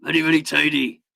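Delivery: put-on Welsh lilt, rising on "tidy"; Welsh accent. Streamer joking that Mount Teede is pronounced tidy, mock Welsh. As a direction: play it very Welsh with a growl. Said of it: "when you do the Welsh".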